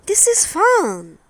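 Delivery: put-on a small girl thrilled on the water; delighted girl.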